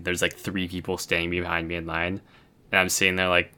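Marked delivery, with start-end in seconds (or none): none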